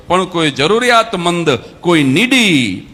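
loud, slow, drawn-out preaching over music; preaching climax. Acted. (ઉપદેશનો ચરમ, જોરથી ધીમે)

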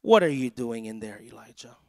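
low-pitched question, falling away on the name; voice of God. Retold or imitated. God questions Elijah hiding in fear, low and solemn. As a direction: drop deep, firm start then hushed name. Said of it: "whisper speaks a word that says".

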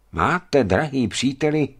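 Čižov exclaiming to the lucky wounded friend; exclaimed. A narrator's reading. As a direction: call it out with amazed delight. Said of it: "zvolal Čižov".

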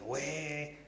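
拉长、偏高而轻的一声“喂”; 学着接电话，声音抬高放轻; 模仿接电话. Retold or imitated. 接起深夜打来的电话. (模仿接电话，轻声拉高)

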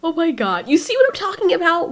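ordinary talk, nothing marked

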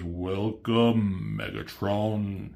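Unicron voice. Retold or imitated. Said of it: "he says".

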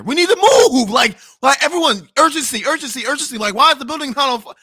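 high, loud, frantic alarm over a fire; panicked yelling. Retold or imitated. Waking up to the fire alarm, yelling in a panic. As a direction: shout everyone awake, rushing the words.

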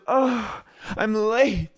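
very high, sighing, drawn out; put-on flustered waitress. Waitress late for her shift, hammed-up sighing fluster. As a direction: sigh through it in a high voice. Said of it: "She goes".

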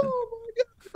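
high, hushed mock gasp as the wife; wife's stunned gasp. Wife hearing he's the interim, high hushed shocked gasp. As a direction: squeak it way up high and soft like she can't believe it. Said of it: "And she's like".

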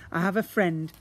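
excited slime; excited exclaim. Acted. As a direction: exclaim it gleefully. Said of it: "exclaimed Slime".

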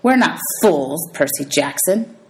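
slow, threatening teacher voice; slow menace. Acted. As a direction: lean on each word like a predator. Menacing teacher reveals herself.

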